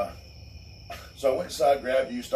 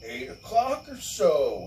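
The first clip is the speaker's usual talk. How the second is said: mocking neighbor voice; 9 semitones higher than usual. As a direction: lightly ham up the prim neighbor. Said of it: "they're like".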